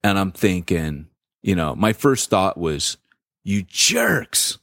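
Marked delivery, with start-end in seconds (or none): 3.5-4.6 annoyed muttered insult. Retold or imitated. Angry thought in church parking lot.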